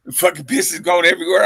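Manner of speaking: ordinary talk, nothing marked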